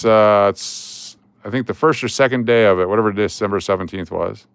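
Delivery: normal talking voice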